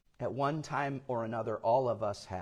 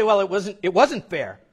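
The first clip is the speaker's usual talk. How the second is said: higher, louder aggrieved protest about fairness; mock grumbling; 10 semitones higher, 10 dB louder than usual. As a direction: voice the grumbling workers, pitched up and loud. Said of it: "You say".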